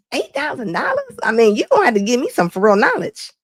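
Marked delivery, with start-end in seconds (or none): 0.1-1.2 shocked disbelief. Real, not acted. Shocked at the price.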